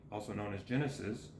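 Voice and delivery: parenthetical aside. Real, not acted. Reader glossing a book title, hushed low aside. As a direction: tuck it in low and fast, under the main reading.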